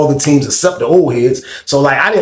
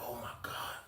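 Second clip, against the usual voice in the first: hushed dismay; 3 semitones lower, 31 dB quieter than usual. Retold or imitated. Quiet dismay at losing.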